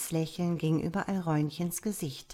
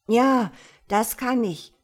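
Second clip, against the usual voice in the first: higher, louder, eager; pleased child; 4 semitones higher, 8 dB louder than usual. A narrator's reading. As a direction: answer keenly, with confidence. Child delighted it can do it. (freudig wie ein Kind)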